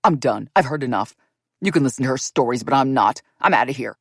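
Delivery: David leaves Robin and the narrator; storming off. A narrator's reading. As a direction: slam the door with your words.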